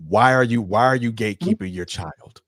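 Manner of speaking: ordinary talk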